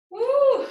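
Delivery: loud, high "woo"; whoop of release. Real, not acted. Teacher recovering after a hard yoga flow, loud whoop. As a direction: let out a high whoop of relief.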